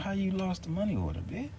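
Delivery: high, indignant disbelief; incredulous retort. Retold or imitated. Snapping at the office that lost his money order, high indignant. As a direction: scoff it up high at them.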